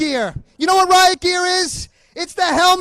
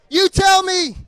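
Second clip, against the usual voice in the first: ordinary talk, nothing marked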